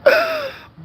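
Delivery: high, sharp gasp of delighted shock; sharp excited gasp. Real, not acted. Streamer spots her sister online and gasps in delight. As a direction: gasp as if you just spotted a long-lost sister.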